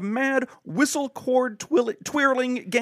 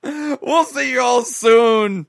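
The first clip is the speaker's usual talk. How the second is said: drawn-out sign-off; 4 semitones higher, 5 dB louder, much slower than usual. Pretend.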